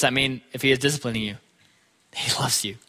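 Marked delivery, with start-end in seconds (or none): 2.1-2.8 an unvoiced hush, tender and close; whispered reassurance. Real, not acted. Preacher whispering to the congregation that God loves them. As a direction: drop to a whisper to comfort them.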